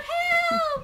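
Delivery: very high, loud, melodramatic cry; great drama. Acted. Storyteller showing the child rabbit how, dramatic cry. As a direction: call for rescue with great drama. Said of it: "with great drama. She cried out".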